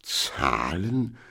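disbelieving one-word question; can't believe his ears; odd-voiced treasurer, stunned; incredulous. A narrator's reading. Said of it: "fragte Al-Hafi ungläubig".

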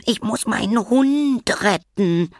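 put-on high, slow, dramatic child voice; a pleading rescue call in play; playacting child; little sister calling. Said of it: "rief Karlchen".

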